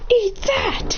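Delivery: high-pitched kick cry; fighting taunt. Acted. Fighter kicking Hashirama down, high-pitched taunt. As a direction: crow it high as the blow lands. Taunts while spinning opponent.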